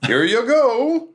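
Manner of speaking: high, drawn-out singsong serving call; hammed-up, high-pitched waiter's flourish; showy chef. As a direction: present the dish slowly with a big upward swoop. Chef presents the birthday meal.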